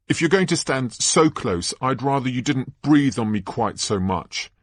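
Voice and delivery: put-on high-pitched, tetchy girl; young Hermione, prim and annoyed. Girl telling a boy not to stand so close, prim and irritated. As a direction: tell him off primly.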